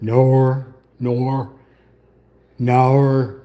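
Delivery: Australian "Nor", no with an R on the end. Shown as a demonstration. Streamer attempting the tough Australian no, Australian accent. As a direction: try the Aussie no, ending in an R. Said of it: "say the word no with an R at the end of it".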